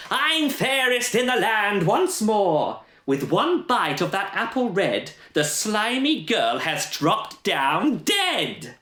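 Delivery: high, slow, triumphant villain voice; gloating Queen. Acted. The Queen gloats over the fallen girl, high and slow. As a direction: savour every word of the victory, high and slow.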